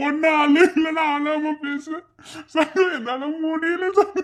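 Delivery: very high, drawn-out words through laughter; laughing hard. Acted. Husband overcome by wife's comic quarrel, laughing hard. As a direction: barely get the words out for laughing. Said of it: "உனக்கு பல்ல பல்ல காட்ட சொன்னாங்களா".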